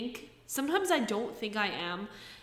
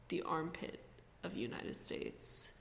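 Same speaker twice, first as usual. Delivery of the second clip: hushed aside; 5 semitones lower, 11 dB quieter than usual. Acted. Jab at New Jersey, dropped aside.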